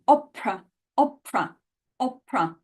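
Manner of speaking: opera with a strong puff of air on the p; English opera, breathy p; aspirated English p. Shown as a demonstration. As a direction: push air through the p in opera. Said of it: "say opera and insist on the P sound".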